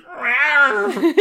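high, loud mock roar; dinosaur voice. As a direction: hiss out a cartoon rawr. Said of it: "And she's like".

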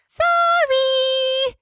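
very high, drawn-out squeaky apology; Pinkie Pie voice. Acted. Podcaster voicing Pinkie Pie apologizing, squeaky and drawn out. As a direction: stretch it out like a sheepish pink pony. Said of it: "And Pinkie Pie's".

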